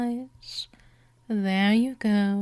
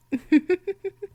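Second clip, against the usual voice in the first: a high, delighted predatory giggle; high mocking laugh; 9 semitones higher, 4 dB louder than usual. Vampire mistress laughing at her prey's denial, high mocking giggle. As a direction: laugh at how easily they give in.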